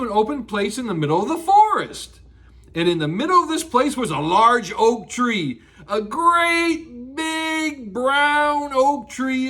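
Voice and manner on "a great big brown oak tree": drawn out, slow and big; slow emphatic. A narrator's reading. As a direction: stretch each word to show size. Storyteller stresses size of tree.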